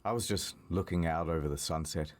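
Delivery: low, pensive mock-dramatic narration; brooding flashback monologue. Narrator caught gazing at the sunset recalls the past, brooding. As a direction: reminisce gravely, with heavy pauses.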